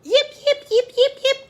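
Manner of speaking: very high, quick little yelps; fox yipping. A narrator's reading. Fox chased away by the dog, high imitated yips. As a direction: yelp like a fleeing fox. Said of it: "cried the fox".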